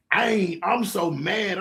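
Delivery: normal talking voice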